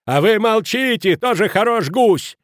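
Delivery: very high, loud, drawn-out outburst; furious. A narrator's reading. Headmaster silencing Kraner, furious. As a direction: explode in outrage. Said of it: "разъярился директор".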